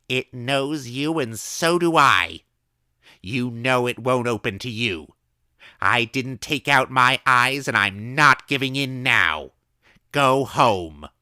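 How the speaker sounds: high, loud, slow command; firm old woman. A narrator's reading. Old woman sending the drunk away through her door. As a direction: send him away firmly.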